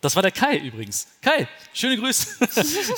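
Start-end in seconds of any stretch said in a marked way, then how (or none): 1.2-2.9 sing-song hello, stretched out and higher; playful stretched shout-out; high, slow greeting. Real, not acted. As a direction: wave hello to Kai with a drawn-out greeting. Greeting friend on camera.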